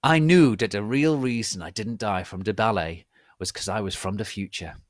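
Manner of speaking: plain reading voice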